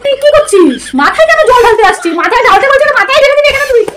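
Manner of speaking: high, laughing alarm; alarmed protest. Acted. Elder sister stopping her sister from pouring water on her head, alarmed and laughing. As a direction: stop her before she pours it.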